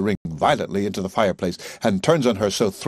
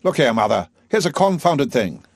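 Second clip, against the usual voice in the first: loud, higher, flustered outburst; agitated Higgins; 6 semitones higher, 6 dB louder than usual. A narrator's reading. Higgins bursting in to tell his mother, agitated. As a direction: burst in all worked up. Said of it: "in a state".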